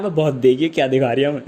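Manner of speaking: ordinary talk, nothing marked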